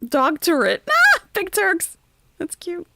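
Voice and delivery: high laughing excitement; excited. Real, not acted. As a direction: squeal it out.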